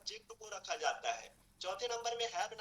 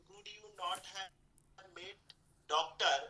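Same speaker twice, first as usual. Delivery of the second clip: very slow, word by word English for students; slow dictation; much slower than usual. Shown as a demonstration. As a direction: spell out each word slowly so the class can follow. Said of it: "इस तरह से आप कई सारे sentence ले सकते हो". (बहुत धीरे, शब्द-शब्द बोलकर समझाना)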